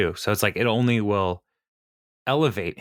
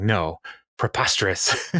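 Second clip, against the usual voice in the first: mock drunk denial; slower than usual. Candidate denying to her interviewer she's drunk, mock drunk denial. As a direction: play tipsy and trying to sound sober. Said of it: "words that drunk people try to use".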